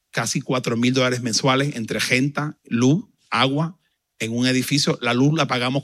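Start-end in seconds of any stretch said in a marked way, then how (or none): none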